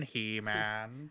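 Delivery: nasal cartoon villain voice; sneering cartoon villain; cartoon Skeletor. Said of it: "the very high-pitched and nasally voice".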